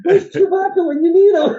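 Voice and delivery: high, loud, through laughter; laughing joke. Real, not acted. Co-host joking about a movie scene, laughing. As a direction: crack the joke while laughing.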